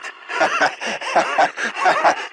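short amused laugh; a dry little laugh; mocking chuckle. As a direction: chuckle at their misfortune. Gloating over broken canister.

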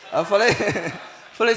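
ordinary talk

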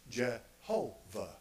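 Jehovah said slowly, every syllable drawn out: je-HO-vah; slow demonstration of Jehovah with the English J; English pronunciation. Shown as a demonstration. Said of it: "We say in English".

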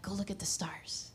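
whispered invitation; hushed playful God; soft whisper of God. Retold or imitated. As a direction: breathe it like a secret. God voiced softly.

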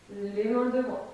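ordinary talk, nothing marked

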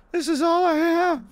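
very high falsetto wail; distressed woman. Acted. Woman about to lose her home, high distressed falsetto. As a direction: plead in a high, helpless lady voice.

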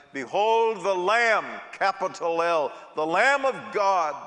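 fervent preacher declaring; preaching. Acted. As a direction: proclaim it from the pulpit. Preaching emphasis.

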